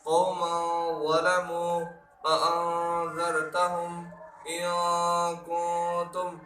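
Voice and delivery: hide the ن of کنتم in a held nasal hum; drawn-out recitation, the n in اانذرتهم softened into nasalisation; ikhfa with ghunna. Shown as a demonstration. Teacher gives examples of true ikhfa, hidden nasal noon. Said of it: "غنے کے ساتھ اور اخفا کے ساتھ پڑھیں گے".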